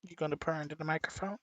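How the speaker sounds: soft, quick coaxing to the cat; talking to cat. Real, not acted. Owner coaxing his cat to purr, soft. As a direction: coax the cat gently.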